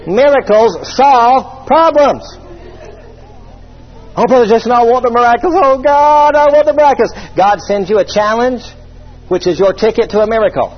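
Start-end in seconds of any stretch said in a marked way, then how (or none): 4.2-7.1 mock whiny churchgoer. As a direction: ham up a needy believer begging. Mocking a whining churchgoer.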